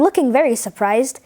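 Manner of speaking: ordinary delivery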